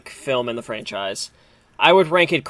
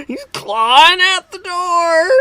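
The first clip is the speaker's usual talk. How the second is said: high, stretched-out hammed-up scream; screaming Nick Cage; 6 dB louder, much slower than usual. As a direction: wail it loudly, dragging out each word. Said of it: "motorcycle screaming".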